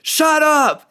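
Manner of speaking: high, loud, slow yell of someone fed up; furious shout. Retold or imitated. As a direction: bellow it at her with everything. Clerk finally yells at lady.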